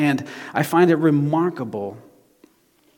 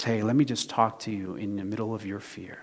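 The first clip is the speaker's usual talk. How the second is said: soft, lower, intimate voice; gentle reassurance; 5 semitones lower, 9 dB quieter than usual. Retold or imitated. The Lord meeting him in his fear, softly reassuring. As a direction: speak quietly to someone who is afraid.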